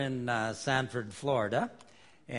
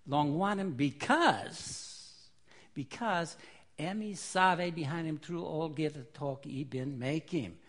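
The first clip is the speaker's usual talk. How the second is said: slow Pidgin reading; Melanesian Pidgin; 3 semitones higher, slower than usual. Shown as a demonstration. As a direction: read it slowly in Melanesian Pidgin. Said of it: "in Melanesian Pidgin".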